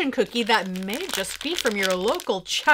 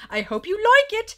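higher-pitched, overdone British "like"; botched British accent; 9 semitones higher, 5 dB louder than usual. Acted. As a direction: try British on "like" and overshoot. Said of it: "I can't say like in the British accent".

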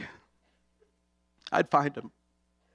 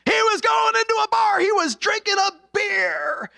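a cheeky puppet teasing a crowd, much higher; puppet routine; 12 semitones higher than usual. Retold or imitated. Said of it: "his little routine".

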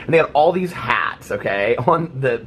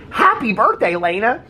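much higher, bubbly birthday wish; cheery greeting; 10 semitones higher, slower than usual. Retold or imitated. Vlogger wishing the stranger at the pool happy birthday, excited. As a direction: greet the stranger like an old friend.

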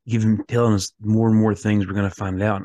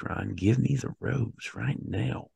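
slow, begging demand, softer; pleading; 7 dB quieter, slower than usual. Pretend. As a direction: beg for the rose slowly. Pleading with the host.

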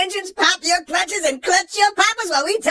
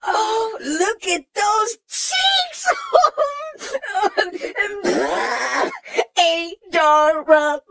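cheek pinching aunt; 3 semitones higher, much slower than usual. Acted. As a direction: coo over the cute babies. Gushing over baby racers.

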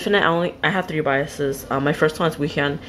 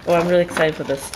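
usual speaking voice

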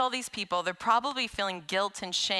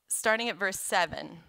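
ordinary talk, nothing marked

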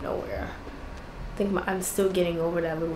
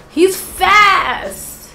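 panicked exclaim; 10 semitones higher, 9 dB louder than usual. Real, not acted. Losing the fight, panicked.

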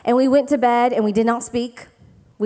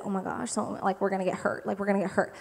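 dog's scared voice; 3 semitones lower, 10 dB quieter, faster than usual. Pretend. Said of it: "he's like".

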